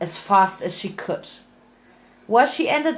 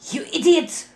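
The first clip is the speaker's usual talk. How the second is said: higher, sharp insult; angry cry; 6 semitones higher than usual. Acted. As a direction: spit out the insult, raised high. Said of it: "cried Covalent Bond".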